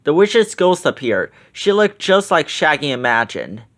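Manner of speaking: usual speaking voice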